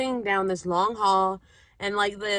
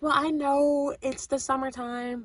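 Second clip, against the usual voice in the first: apologetic pitch; 7 semitones higher, slower than usual. Retold or imitated. Acting out her hesitant pitch.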